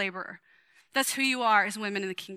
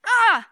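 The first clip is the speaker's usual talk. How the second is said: high, loud mock cry of Ah; hammed-up apostle shout; wild yell; 9 semitones higher, 9 dB louder than usual. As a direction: let out a burst like a frenzied preacher. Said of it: "He gets wild".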